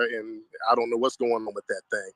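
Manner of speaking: ordinary talk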